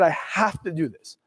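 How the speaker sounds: low, slow and soft, steeling himself; slow, low and soft, committing to the decision; quiet resolve. Retold or imitated. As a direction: say it under your breath like a vow to yourself.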